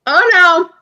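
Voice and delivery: rising alarmed oh no; mock alarm. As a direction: gasp it in mock dread. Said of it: "my brother was even like".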